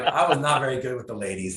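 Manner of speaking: ordinary talk, nothing marked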